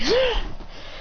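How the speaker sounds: high, sharp acted gasp; mock gasp of sudden pain. Character cuts his hand.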